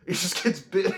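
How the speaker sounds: high, loud, breaking into laughter; cracking up. Real, not acted. Fan retelling a funny bite scene, cracking up. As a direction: burst out laughing as you finish.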